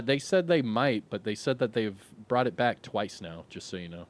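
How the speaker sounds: ordinary talk, nothing marked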